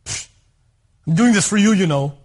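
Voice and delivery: huffy, loud mock grumble; huff, then push the favor at her; angry. Husband driving wife to dinner, acting angry. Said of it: "angry the whole drive".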